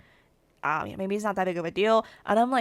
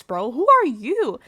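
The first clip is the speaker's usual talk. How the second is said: ordinary talk, nothing marked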